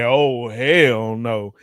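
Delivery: drawn-out, slow catchphrase; emphatic refusal. Acted. Host says the segment's catchphrase over running out of drink, emphatic refusal. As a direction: stretch every word out in refusal. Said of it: "that'll definitely make me say".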